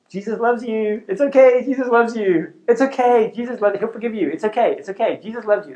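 high, loud, sing-song parody of cheap comfort; mock sugary reassurance. Youth leader mocking a shallow teaching, sing-song high parody.

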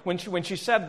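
ordinary talk

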